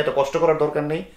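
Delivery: ordinary talk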